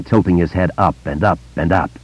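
ordinary delivery, nothing marked

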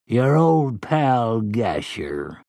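slow, sly villain voice. Acted. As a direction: draw it out like a leering thug. Voicing Gasher's catchphrase.

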